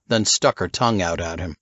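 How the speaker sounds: plain reading voice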